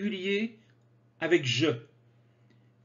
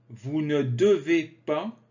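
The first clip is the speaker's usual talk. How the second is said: ordinary talk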